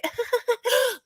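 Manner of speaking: high-pitched mock laugh; short put-on high giggle; imitated laugh. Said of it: "she was like".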